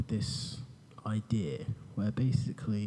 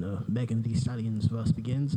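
normal talking voice

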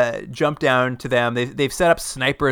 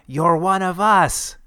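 high, slow and eerie; eerie whisper voice; 6 semitones higher, slower than usual. Retold or imitated. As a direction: breathe it slowly like a creepy stranger. Villain whispering in his ear.

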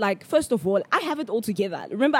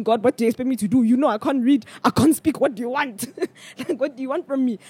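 hammed-up, pleading protest, rising higher; exasperated plea to God; 4 semitones higher than usual. Praying in her head while unable to read, exasperated. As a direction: plead with God, half laughing by the end.

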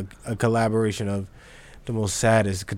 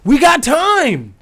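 high, loud, worked-up protest; incredulous rant; 9 semitones higher, 4 dB louder than usual. Real, not acted. Co-host scolding how the remix was done, loud high incredulity. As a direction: rant in high-pitched disbelief at a friend. Co-host berates the party plan.

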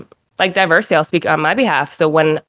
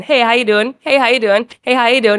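high, friendly mock greeting; Southern hospitality; 6 semitones higher than usual. Imitating southerners greeting dad at move-in, friendly and high. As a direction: chirp it as to every passer-by. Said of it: "Southern hospitality".

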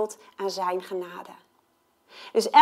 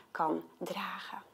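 slow hushed whisper; soft awed close; 3 semitones lower, 15 dB quieter, slower than usual. Real, not acted. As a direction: whisper it with reverence. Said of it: "whispered ending".

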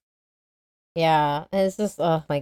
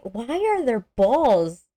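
mock baffled question; 6 semitones higher, slower than usual. Retelling own shocked thought.

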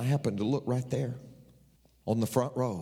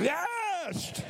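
much higher cheer; mock roaring response. Pastor mimicking the roaring congregation he hoped for, mock cheer. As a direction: cheer like the crowd he wished for. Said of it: "hoping there would be a roaring response".

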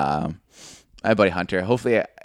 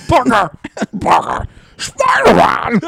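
much higher, loud stretched-out bellow; J. Jonah Jameson; 13 semitones higher, 7 dB louder, slower than usual. Acted. Friend doing a comic-book editor, slow high shouting. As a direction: roar it like a furious newspaper boss. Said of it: "He doesn't talk like J. Jonah Jameson".

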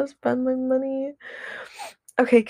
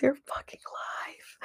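emphatic whisper, sharing a secret; 4 semitones higher, 11 dB quieter, slower than usual. Real, not acted. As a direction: whisper it like a confession.

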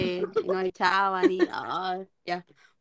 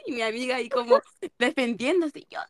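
normal talking voice

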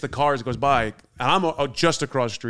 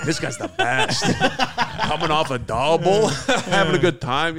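real laughter through the words; giggling fondly; laughing; 4 semitones higher, 4 dB louder than usual. Real, not acted. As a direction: laugh while telling it. Laughing retelling fond drunk guy.

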